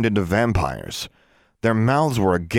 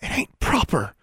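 much higher, hushed prim Ozark voice; whispered Southern disapproval; 11 semitones higher than usual. A narrator's reading. Forbearance whispers disapproval of the couple. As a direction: whisper it primly in a country accent. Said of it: "Forbearance whispered".

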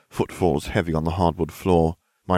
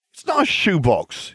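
snapped; 12 semitones higher, 3 dB louder than usual. A narrator's reading. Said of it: "he snapped".

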